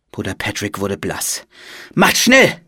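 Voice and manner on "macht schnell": high, loud order to hurry; urgent call. A narrator's reading. Monk calls to his brothers, urgent shout. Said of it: "rief er den anderen Mönchen zu".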